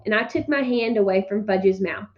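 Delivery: plain reading voice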